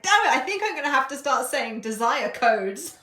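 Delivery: loud, high, laughing protest; amused mock exasperation. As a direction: curse yourself while cracking up.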